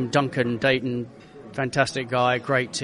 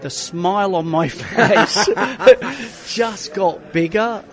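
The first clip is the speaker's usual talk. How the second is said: amused, higher and louder, laughing through it; 6 semitones higher, 5 dB louder, slower than usual. Real, not acted. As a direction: stretch the words out slowly through a laugh. Laughing while recalling first drive.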